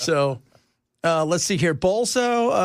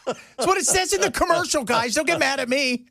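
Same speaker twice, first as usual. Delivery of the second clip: high-pitched protest; 8 semitones higher than usual. Real, not acted. Mock defensive to listeners.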